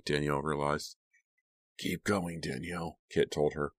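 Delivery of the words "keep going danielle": hushed instruction; quiet, controlled prompt; soft urging. A narrator's reading. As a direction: urge her on quietly. Kit quietly urging her on.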